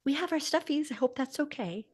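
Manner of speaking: a hint of a gentle, apologetic kindergarten teacher; sweet teacher. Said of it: "And she says".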